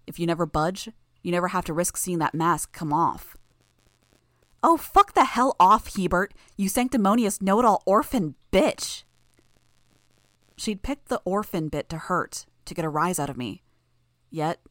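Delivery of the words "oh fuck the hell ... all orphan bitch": angry. Acted. Sophia insulting Taylor.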